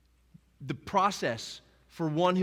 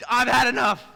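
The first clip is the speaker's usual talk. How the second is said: loud, high mock shout of exasperation; mock fed-up Jesus; 10 semitones higher, 11 dB louder than usual. Imagining Jesus unleashing power like the Avengers, loud mock shout. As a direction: belt it like an action hero's last line.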